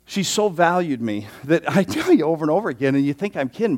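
ordinary delivery

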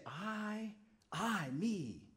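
"I, I, me" drawn out and pitched high; emphatic I. Real, not acted. Pastor urging listeners to own the choice, emphatic "I, I, me". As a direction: lean hard on each "I" and "me", high and held.

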